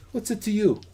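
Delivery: high, sassy retort; defiant. Acted. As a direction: toss it back defiantly. Mercenary snaps back at companion.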